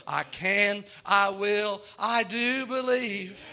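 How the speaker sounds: slow, deliberate creed; slow firm declaration. Retold or imitated. Believer answering the devil, slow and firm. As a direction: plant each phrase like a stake.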